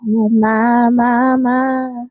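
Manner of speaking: slow, breathy-sounding seductive ad read; sultry perfume ad. Pretend. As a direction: purr it slowly like a perfume commercial. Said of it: "that's a sultry voice right there".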